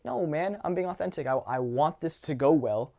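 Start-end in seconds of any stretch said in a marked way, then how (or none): none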